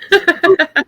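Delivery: high pained laugh; laughing through pain. Real, not acted. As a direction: laugh that hurts. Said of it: "oh, God, it hurts to laugh".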